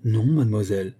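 mildly firm refusal; understated determination in character; resolute. A narrator's reading. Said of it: "fit résolument Ladoff".

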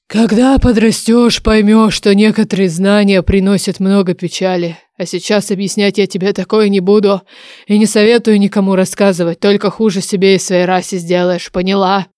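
stern dragon laying down the law; admonishing dragon oracle. Acted. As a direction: lecture a youngster as an ancient oracle. Oracle lectures the heroine.

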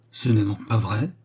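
ordinary delivery, nothing marked

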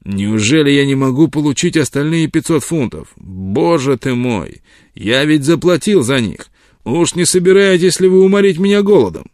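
impotent rage. Acted. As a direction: rage you cannot act on. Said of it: "в бессильной ярости".

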